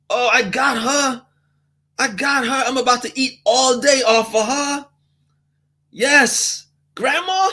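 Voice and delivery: high, gleeful freeloader sizing up an easy mark; scheming customer voice. Acted. Voicing scheming customers sizing up grandma.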